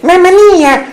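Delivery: put-on a tiny baby Mario exclaiming. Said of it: "there are the babies".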